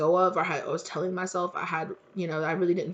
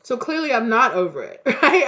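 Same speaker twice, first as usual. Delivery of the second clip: laughing at her own grudge, voice high and louder; 5 semitones higher, 8 dB louder than usual. Real, not acted. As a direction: laugh it off with a rising right. Laughs at herself.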